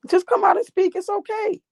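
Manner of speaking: high, sing-song fake reassurance; babying, teasingly encouraging; mock coaxing. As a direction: coax them out in a high little voice. Mock-coaxing a lurker out of hiding.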